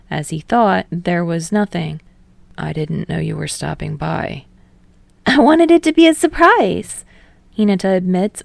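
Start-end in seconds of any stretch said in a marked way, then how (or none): 2.5-4.4 a low, softer, lightly puzzled reply. Acted. Tsukishima caught off guard by Hinata's visit, low and mildly puzzled. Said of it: "his voice shifts with puzzlement". 5.2-7.0 high, louder, beaming voice. Acted. Hinata explaining his surprise visit, high and beaming. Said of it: "unapologetically beaming".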